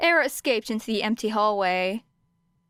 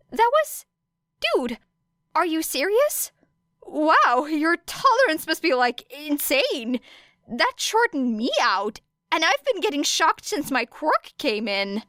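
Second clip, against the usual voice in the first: gushing with amazement; excited teen in awe; awed excitement; 8 semitones higher than usual. Acted. Kaminari gushes in awe.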